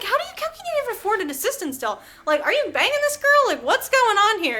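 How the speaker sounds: high, fast, baffled questioning; rapid high-pitched incredulity; incredulous. Real, not acted. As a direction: demand an explanation in squeaky disbelief. Incredulous questions about author's money.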